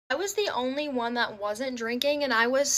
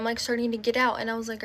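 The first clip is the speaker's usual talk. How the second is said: ordinary delivery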